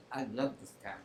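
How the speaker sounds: ordinary talk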